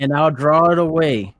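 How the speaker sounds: in-character yell. Acted. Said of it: "he yells".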